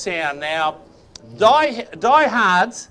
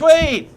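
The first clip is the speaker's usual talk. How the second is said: louder high "Tweet." like a bird; 7 semitones higher, 5 dB louder than usual. Shown as a demonstration. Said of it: "No, a bit louder".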